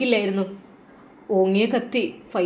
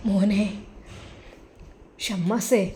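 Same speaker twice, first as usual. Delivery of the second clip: a soft, pleading call to the son; a broken father's pleading call; pitiful call; 2 semitones lower, 8 dB quieter, slower than usual. A narrator's reading. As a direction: call him gently, full of pity. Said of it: "വളരെയധികം ദയനീയമായാണ് ഷമ്മാസിനെ വിളിക്കുന്നത്".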